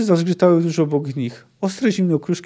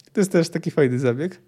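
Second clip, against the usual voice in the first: ordinary delivery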